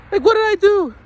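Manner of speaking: high, loud mock-innocent whine; squeaky aggrieved kid voice; protesting kid. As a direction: imitate a child pleading innocence.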